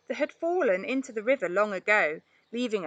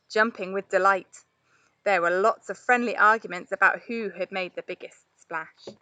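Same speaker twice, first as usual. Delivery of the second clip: ordinary delivery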